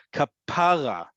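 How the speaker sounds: kapara with the middle syllable stressed, ka-PA-ra; stress on second-to-last syllable. Shown as a demonstration. Teacher showing students the term of endearment, kapara stressed on PA. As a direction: lean on the "PA" in kapara. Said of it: "listen to how I say it".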